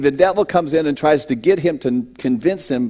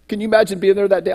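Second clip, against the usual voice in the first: ordinary talk, nothing marked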